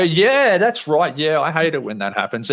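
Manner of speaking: eager agreement. Retold or imitated. Friend egging on a complaint, eager agreeing. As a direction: mimic someone gleefully agreeing.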